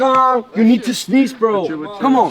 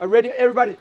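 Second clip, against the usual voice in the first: normal talking voice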